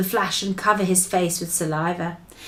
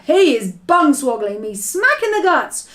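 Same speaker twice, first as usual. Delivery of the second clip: raised, outraged shout; 5 semitones higher, 4 dB louder than usual. A narrator's reading. Giant woken by a kick in the guts, shouted. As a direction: shout it as if kicked. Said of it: "shouted the gizzard gulper".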